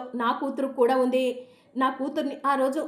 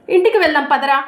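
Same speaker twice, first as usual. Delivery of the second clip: urgent loud order; 3 semitones higher, 11 dB louder, faster than usual. Acted. Father tells his son to rush home, loud and urgent. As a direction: urge him off now.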